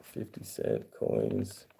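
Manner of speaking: slow breathy whisper of "Fifty cent coins". Shown as a demonstration. Repeating the coin name to viewers, slow whisper.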